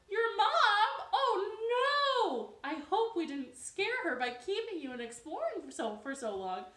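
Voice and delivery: high, loud, crying dismay; worried frog. Acted. Jessica fearing they worried the mother, crying out. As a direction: fret that they scared her. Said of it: "Jessica said, gasps".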